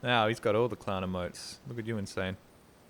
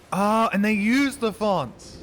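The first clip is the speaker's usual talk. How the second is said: surprised; 11 semitones higher, 9 dB louder, slower than usual. Real, not acted. Surprised discovery about Undertale fonts.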